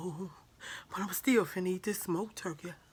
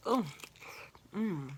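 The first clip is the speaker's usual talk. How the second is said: ordinary delivery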